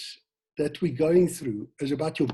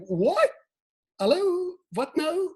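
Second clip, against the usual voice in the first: mock confusion; 12 semitones higher, slower than usual. Emoticon confused face act.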